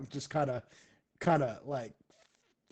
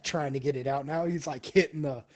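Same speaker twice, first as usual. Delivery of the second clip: ordinary talk, nothing marked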